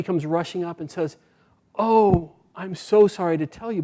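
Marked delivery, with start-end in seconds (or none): 1.8-3.8 raised, lamenting storyteller's apology; a messenger's emphatic sympathy; apologetic bad news. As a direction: break bad news with acted dismay. Messenger brings bad news to owner.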